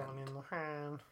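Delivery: low, soft imitation of a robot; robotic voice. Co-host echoing the show's robotic jingle, mock robot voice. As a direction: quietly echo a robotic jingle. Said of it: "given it's literally a robotic voice saying".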